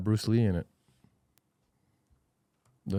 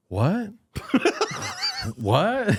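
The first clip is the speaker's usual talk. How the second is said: mock disbelief; 11 semitones higher than usual. Son reacts to voicemail prank.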